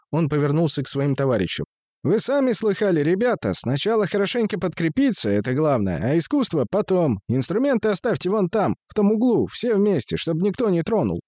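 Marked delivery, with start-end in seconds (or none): 2.0-11.2 high-pitched voice. Acted. Musician addresses his mates.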